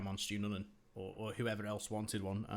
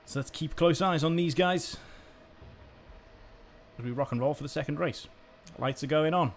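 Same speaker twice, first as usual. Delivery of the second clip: energetic play-by-play, louder; live race commentary; 5 semitones higher, 7 dB louder than usual. Acted. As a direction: call the race as it unfolds.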